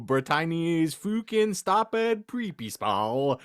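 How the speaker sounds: normal talking voice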